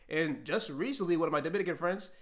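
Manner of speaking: usual speaking voice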